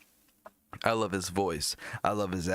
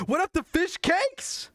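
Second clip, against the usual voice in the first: very high, louder squealed greeting; excited greeting; 4 dB louder than usual. Acted. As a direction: greet them like a thrilled fan. Shrieked greeting to a band.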